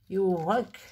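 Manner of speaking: English words in a French accent; proudly bad English accent, drawn out; self-mocking English accent. Real, not acted. Crafter reading her English stamp label, in a French-accented English. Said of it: "Avec mon super accent".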